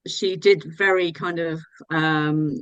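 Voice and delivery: ordinary delivery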